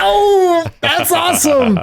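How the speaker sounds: high, sarcastic fake excitement; mock gushing. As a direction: squeal a sarcastic "that's awesome". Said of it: "And I'm like".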